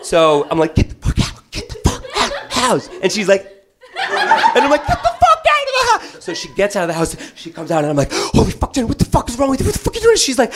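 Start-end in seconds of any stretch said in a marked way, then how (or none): 0.7-2.9 higher panicked half whisper-yell; hiss-yell her out of the house; mock panicked yell. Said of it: "So I'm fucking yelling". 4.9-6.2 much higher, laughing yell; panicked yell. Retold or imitated. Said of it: "So I'm fucking yelling". 8.1-10.2 much higher, exasperated; mock outraged scold. Scolding wife after break-in.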